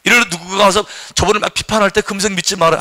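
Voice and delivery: usual speaking voice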